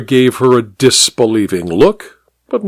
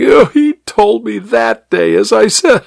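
weeping; 10 semitones higher than usual. A narrator's reading. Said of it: "she resumed her weeping".

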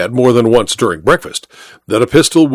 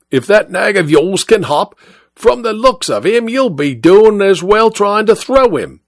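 higher, brushing off the rival; dismissive cabby; 7 semitones higher than usual. Acted. Second cabby tells the first to clear off, higher and curt. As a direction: shoo him off with a higher jab.

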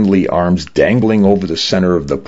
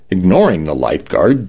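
ordinary delivery

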